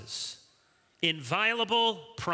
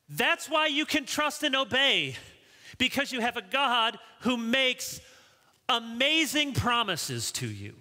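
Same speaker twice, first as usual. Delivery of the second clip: high, impassioned sermon delivery; emphatic preaching; 9 semitones higher than usual. Real, not acted. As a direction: drive the point home to the congregation. Preaches with full force.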